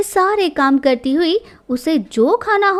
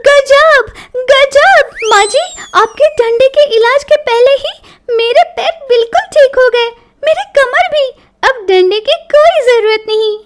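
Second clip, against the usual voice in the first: loud, high-pitched excited gushing; feigned amazement; 8 semitones higher, 6 dB louder than usual. A narrator's reading. Daughter-in-law escaping the stick, excitedly amazed. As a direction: gush about a sudden miracle cure.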